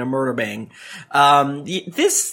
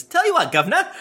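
higher Cockney villain; Cockney accent, higher and sly; 13 semitones higher, faster than usual. Acted. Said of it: "a term Cockney use".

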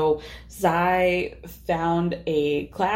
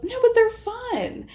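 whiny protest; slower than usual. Retold or imitated. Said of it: "And Erin goes".

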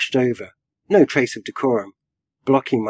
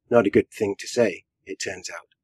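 ordinary delivery, nothing marked

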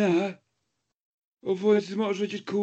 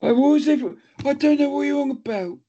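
pitched up and louder, defensive; high defensive voice; 5 semitones higher, 4 dB louder, faster than usual. Real, not acted. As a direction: squeak out a denial. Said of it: "notice how that voice changed".

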